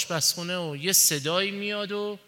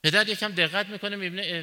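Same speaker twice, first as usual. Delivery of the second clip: normal talking voice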